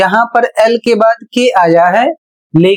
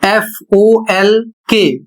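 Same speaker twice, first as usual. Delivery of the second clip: very slow letter-by-letter spelling; spelling it out for students; spelled out; much slower than usual. Shown as a demonstration. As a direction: spell एफ़ओएलके very slowly, one letter at a time. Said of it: "जैसे".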